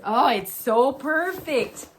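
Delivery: loud, high, drawn-out delight; excited gushing. Real, not acted. Fan showing off her show-themed tote, excited gushing.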